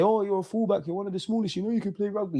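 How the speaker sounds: high, soft imitation of a coaxing teacher; teacher's encouragement. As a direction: voice a teacher talking a pupil up, pitched high. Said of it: "He used to say".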